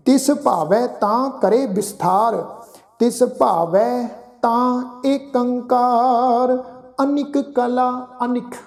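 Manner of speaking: slow, drawn-out sacred recitation; measured Gurbani reading, sustained line endings; scripture recitation. Shown as a demonstration. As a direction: chant each phrase slowly with long held vowels.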